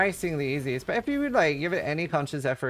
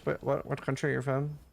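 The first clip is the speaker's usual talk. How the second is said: normal talking voice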